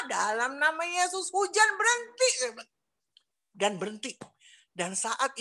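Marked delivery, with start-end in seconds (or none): 0.1-2.3 prayer climbing high on the final order; retold prayer command. Woman repeating her prayer after exercise, rising commanding voice. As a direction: build upward to a high command. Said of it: "Saya katakan juga yang sama".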